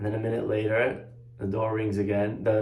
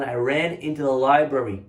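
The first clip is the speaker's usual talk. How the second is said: ordinary talk, nothing marked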